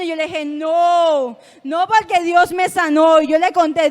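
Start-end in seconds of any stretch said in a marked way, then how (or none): 0.4-3.2 loud, emphatic refusal; negativa rotunda. Retold or imitated. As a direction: refuse firmly at full volume. Rechaza la inyección ante el médico. (negativa rotunda en voz alta)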